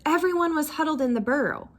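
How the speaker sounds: plain reading voice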